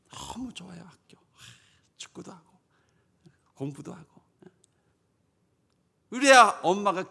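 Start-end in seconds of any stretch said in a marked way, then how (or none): 0.1-4.2 put-on hushed, very soft child's delight; lightly voiced child, almost whispering; happy schoolchild. Child telling mom school is great, hushed delight. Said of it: "어떻게 가야 돼요?".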